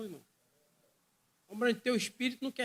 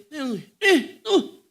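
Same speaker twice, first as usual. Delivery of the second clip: with anointing; 6 semitones higher, 15 dB louder, much slower than usual. Retold or imitated. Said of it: "Só que agora com unção".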